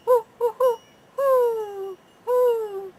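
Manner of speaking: high, loud hooting like a great horned owl; owl hoots. Acted. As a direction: call out into the night like an owl. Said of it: "The sound of a great horned owl".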